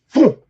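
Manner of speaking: sudden punchy "phoom"; imitated whoosh. Angler shows the perch vanishing, sudden whoosh noise. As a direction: mimic fish shooting away.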